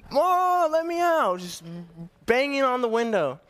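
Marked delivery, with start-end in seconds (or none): 0.1-1.4 higher, louder little-girl plea, mock tearful; a lightly acted tearful child calling for mom; crying child. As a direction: imitate a small kid begging through a car window. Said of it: "crying, saying".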